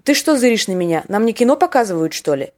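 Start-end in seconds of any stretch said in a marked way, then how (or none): none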